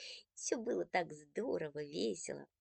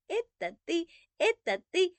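high, loud gleeful cry; little brother cheering up high; joyful shout; 10 semitones higher, 6 dB louder than usual. Acted. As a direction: crow it like a happy kid. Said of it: "обрадованно закричал Андрейка".

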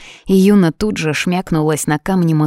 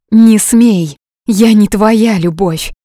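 loud, higher furious shout; 5 semitones higher, 7 dB louder, slower than usual. A narrator's reading. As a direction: throw it back at him. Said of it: "крикнула Юна".

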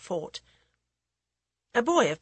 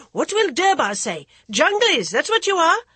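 scolding women; 11 semitones higher, 8 dB louder than usual. Acted. Women beat the children.

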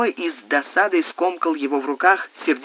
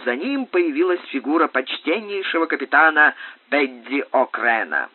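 usual speaking voice

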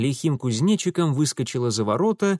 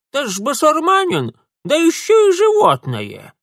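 put-on old woman voice; 3 semitones higher, 6 dB louder than usual. Болтливая старуха, голос бабки.

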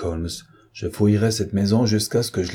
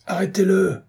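ordre crié bien plus aigu; 11 semitones higher than usual. Acted. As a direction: pousser les agents à agir. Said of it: "cria Peters". (cri vers les policiers, voix très haute)

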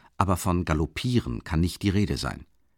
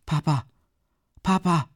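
put-on higher, pleading child call; 9 semitones higher than usual. A narrator's reading. Boy calling to his father in distress, pleading. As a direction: cry out to father. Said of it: "ruft der Knabe dem Vater zu".

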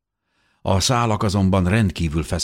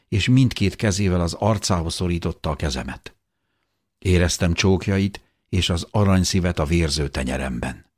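ordinary delivery

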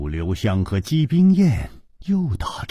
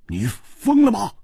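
higher, startled cry; the words escape before he can stop them; blurting out in shock; 11 semitones higher than usual. A narrator's reading. Stunned rival sees her cut her own leg, shocked. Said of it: "失声说".

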